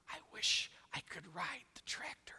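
whispered private wish; hushed, whispered daydream; wistful whisper. Acted. Boy's private wishful thought.

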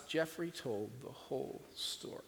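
slow, hushed whisper; whispered sadness. A narrator's reading. Storyteller whispering how the boy told his story through sobs. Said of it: "through his sobs".

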